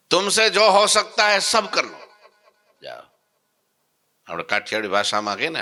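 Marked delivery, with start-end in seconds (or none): 0.1-3.0 high, loud laughing challenge; amused dare. Retold or imitated. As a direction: laugh off his threats. Maharaj dares the madari.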